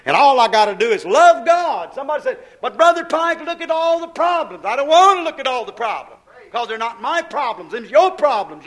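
high, loud pulpit fervor; impassioned preaching. Real, not acted. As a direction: preach it out forcefully to the crowd.

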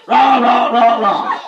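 laughing mock cheerleader holler; mock cheer holler. Preacher mocking a cheerleader flipping over, mock holler. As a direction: tease a schoolgirl's cheer. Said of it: "she gets back up and she hollers".

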